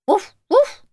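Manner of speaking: high, loud woof woof; cartoon dog barking; playful dog bark. Dog barks.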